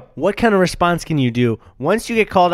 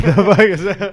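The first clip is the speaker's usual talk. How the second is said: loud, higher, laughing question; cracking up; 6 semitones higher, 6 dB louder than usual. Real, not acted. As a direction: burst out laughing mid-question. Laughing over the mishearing.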